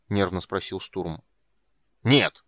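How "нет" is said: short, loud, annoyed no; irritated snap. A narrator's reading. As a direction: cut him off irritably. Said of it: "раздражённо ответил гном".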